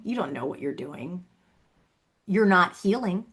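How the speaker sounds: ordinary delivery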